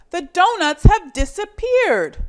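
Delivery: high, loud dismayed announcement; alarmed. Acted. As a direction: sound the alarm about the donuts. Dave alarmed at missing donuts.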